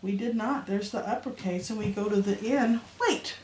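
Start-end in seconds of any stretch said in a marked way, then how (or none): 3.0-3.4 a sharp, high "Wait!"; sudden surprise. Real, not acted. Teacher catching her own mistake, surprised. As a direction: stop yourself short in surprise. Teacher spots a question mark.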